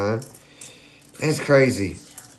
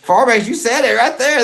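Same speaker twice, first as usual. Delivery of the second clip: ordinary talk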